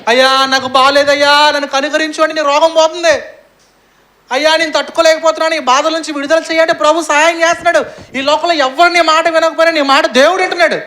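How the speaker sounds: high, loud impassioned sermon; preacher at the peak of his sermon; full-force preaching. Acted. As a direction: cry it out over the congregation.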